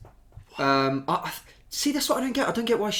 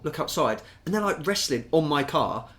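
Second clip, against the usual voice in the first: ordinary talk, nothing marked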